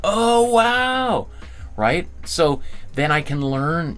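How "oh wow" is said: surprised. Retold or imitated. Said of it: "I'll be like".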